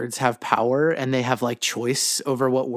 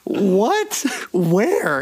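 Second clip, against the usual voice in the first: high startled what and where; shocked; 6 semitones higher than usual. Retold or imitated. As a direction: caught off guard, blurting it out. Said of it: "And I was like".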